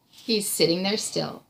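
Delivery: usual speaking voice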